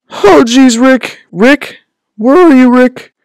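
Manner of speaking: very high, loud fretful cartoon voice; cartoon sidekick. Streamer fitting in another impression, high anxious sidekick voice. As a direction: call out for your partner in a high squeal.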